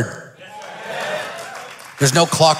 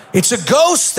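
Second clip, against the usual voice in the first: frightened cry; 9 semitones higher, slower than usual. A narrator's reading. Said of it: "they cried out in fear".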